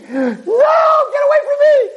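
long, high terrified scream. Retold or imitated. Trapped man to the woman grabbing him, screaming in terror. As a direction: scream it drawn out, fighting her off. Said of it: "And he starts screaming".

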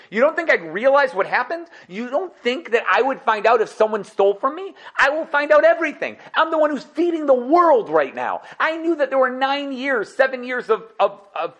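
hammed-up boastful king; Yosef proclaiming. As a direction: announce your rank grandly. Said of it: "said Yosef".

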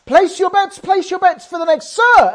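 loud, high croupier call. Retold or imitated. Croupier calling to Kevin across the table, loud and high. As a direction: call the bets across the casino floor.

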